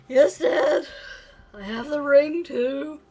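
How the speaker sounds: put-on young boy reporting, high and loud; dutiful kid. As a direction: answer like an eager son. Son answers father's checklist.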